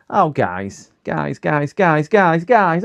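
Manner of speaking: rising panic, calling the chat again and again; alarmed calling out. Real, not acted. Streamer alerting his chat to a problem, building from urgent to frantic.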